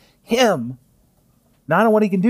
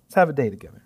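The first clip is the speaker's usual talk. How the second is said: cheerful wake-up; 6 semitones higher than usual. Retold or imitated. Jesus waking his child, bright and eager. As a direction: rouse a child with delight. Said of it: "He says".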